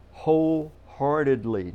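"wholeheartedly" loud and drawn out, syllable by syllable; stress on wholeheartedly. Real, not acted. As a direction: stretch "wholeheartedly" slowly with heavy weight. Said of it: "listen to this word".

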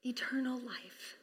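soft unvoiced whisper; whispered emphasis. Real, not acted. Pastor hushing the congregation on eternal life, whispering. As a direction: hush it like a secret.

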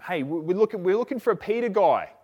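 high, loud calling at a door; mock yell from outside the house. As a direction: call out for someone inside. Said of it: "these people yelling out for him".

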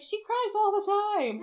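very high imitation of a son; Aiden. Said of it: "Aiden's like".

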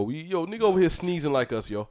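normal talking voice

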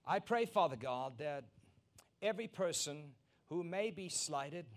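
earnest pulpit prayer. Acted. Pastor praying over the congregation, earnest. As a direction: pray over the church, earnest and lifted.

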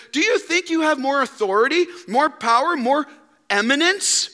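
high, building rhetorical challenge; indignant woman. Acted. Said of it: "she says".

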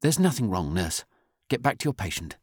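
brusque senior nurse giving orders; brusque authority. A narrator's reading. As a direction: shut down the question with authority. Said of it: "said with brusque authority".

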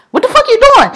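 high, sharp yell at the trespasser; high-pitched what-the-hell challenge; retold furious yell. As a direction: yell it up high like catching someone in your yard. Neighbor yelling at Marine.

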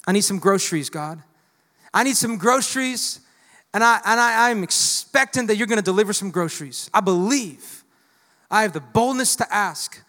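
put-on loud, pleading old woman's prayer; old woman praying for groceries on her porch; bold prayer. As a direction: ask God boldly out loud. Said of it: "she was like".

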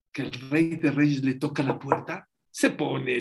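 ordinary talk, nothing marked

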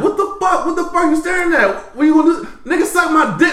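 high-pitched, heated road-rage tirade; going in on him. Retold or imitated. Retelling a rant at an older driver through the car window, high angry yelling. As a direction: re-enact tearing into another driver, voice shooting up. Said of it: "I'm going in like".

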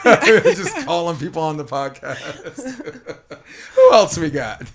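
talking through laughter; laughing. Real, not acted. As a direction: chuckle between the words. Laughing after the surprise call.

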